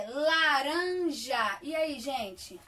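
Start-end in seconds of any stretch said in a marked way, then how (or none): 0.1-1.6 laranja drawn out slowly in three parts; syllable-clapping. Shown as a demonstration. Teacher clapping syllables for children, slow and spaced. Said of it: "três pedacinhos".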